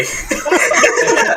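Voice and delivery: breathy high laugh at his own joke; unvoiced laugh. Real, not acted. Player cracking up at his own crude line, laughing. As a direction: burst into a silent wheeze of laughter.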